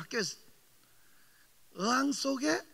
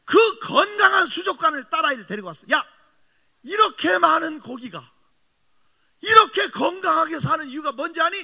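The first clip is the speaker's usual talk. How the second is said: loud, high preaching cry, slowed on key words; preaching shout; 4 semitones higher, 11 dB louder than usual. Real, not acted. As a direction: proclaim it at full force to the daughter. 설교 절정의 외침. (딸에게 크게 외치듯 힘주어 설교하며)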